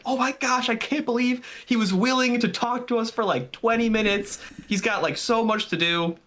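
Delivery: high, giddy retelling of amazement; excited disbelief. Retold or imitated. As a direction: gush like you can't believe your luck. Recalls reaction after the interview.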